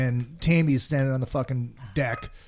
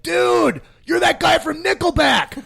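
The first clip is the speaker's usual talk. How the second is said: high, loud yell of recognition; excited shout; 6 dB louder, slower than usual. Retold or imitated. Driver hollering at a star out the window, excited shout. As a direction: shout it out to someone across the way.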